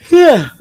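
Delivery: sudden high-pitched hiccup; loud hiccup. Real, not acted. Streamer interrupted while reading chat, hiccuping. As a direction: let a sharp, loud hiccup burst out. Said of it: "I don't know that she hiccuped".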